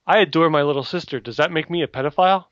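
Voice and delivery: ordinary talk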